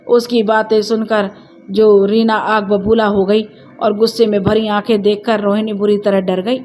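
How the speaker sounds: usual speaking voice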